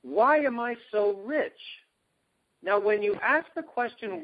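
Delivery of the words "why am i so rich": wondering. Retold or imitated. Retold question to the brain.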